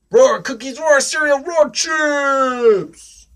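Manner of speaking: high, loud punchy roars; roaring little dinosaur. Acted. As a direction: shout each item after a roar. Dinosaur roars through the challenge.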